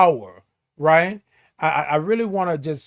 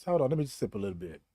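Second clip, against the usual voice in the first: ordinary talk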